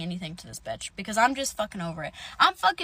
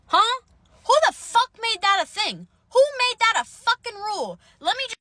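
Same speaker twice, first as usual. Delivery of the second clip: high, loud indignant outburst; outraged disbelief; 11 semitones higher, 6 dB louder than usual. Retold or imitated. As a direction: fire off incredulous questions, pitched way up. Indignant outburst at the cop's rule.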